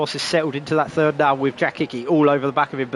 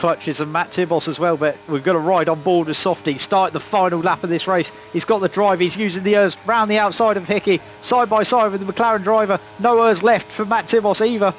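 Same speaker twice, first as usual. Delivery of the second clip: higher, lively live race call; motorsport commentator; 5 semitones higher than usual. Acted.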